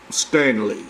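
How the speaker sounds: low, drawn-out brand voice; Stanley voice. Shown as a demonstration. Presenting his own Stanley brand voice. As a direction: announce the name deep like a commercial. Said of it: "watch me do my Stanley voice".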